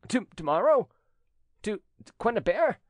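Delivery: high, loud, stammered questions; shocked stammer. A narrator's reading. Jilted cousin hearing of tomorrow's wedding, stammering in shock. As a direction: stumble on the words in disbelief. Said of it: "stammered Trumeau".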